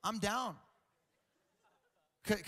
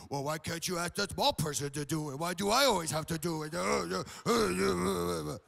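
low gym-bro grumble trailing into noises; whining big guy; 3 semitones lower than usual. Retold or imitated. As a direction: complain like a naggy meathead. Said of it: "that's how they talk".

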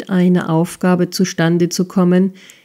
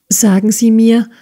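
plain reading voice

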